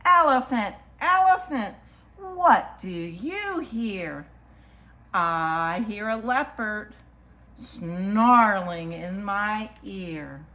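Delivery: slow, lilting picture-book read; reading to children. Acted. Storyteller reads a picture book to children, lilting. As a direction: read it rhythmically, stretching the key animal words.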